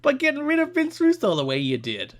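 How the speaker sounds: high, loud cartoon New Jersey accent, cracking into laughs. Reading a fan's letter to Jarrett in a New Jersey accent. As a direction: read the fan letter like Joey Wheeler. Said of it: "read this in a New Jersey accent".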